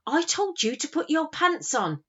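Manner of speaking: high-pitched mock-stern telling-off; trying not to crack. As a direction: scold your son while holding back a laugh. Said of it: "desperately trying not to crack".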